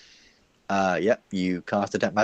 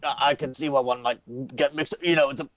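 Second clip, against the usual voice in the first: high, halting shopkeeper voice; nervous shopkeeper; 6 semitones higher than usual. Acted. Shopkeeper excusing a mix-up to threatening customer, nervous. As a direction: make excuses haltingly, pitched up.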